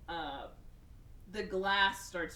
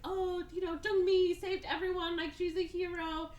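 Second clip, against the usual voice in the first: high-pitched imitated acclaim; mock gushing; 11 semitones higher than usual. Reporters photograph the heroine, mock gushing. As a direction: gush in a high, put-on voice.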